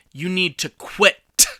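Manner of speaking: QUIT with a sharp, popped extra T at the end; extra hard final T. Retold or imitated. Daughter arguing with someone, QUIT ending on an over-hit T. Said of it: "she'll put that extra t".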